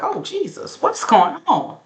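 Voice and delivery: high, shocked gasp of alarm; mock shock. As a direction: react like you can't believe the chaos. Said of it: "I'm like".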